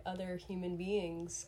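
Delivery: ordinary delivery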